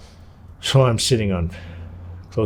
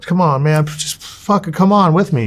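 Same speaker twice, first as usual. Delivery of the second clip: much higher and louder, begging him to come; pleading urge; 8 semitones higher, 5 dB louder than usual. Retold or imitated. Nephew begging his uncle to come along, loud pleading. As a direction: push and plead at once.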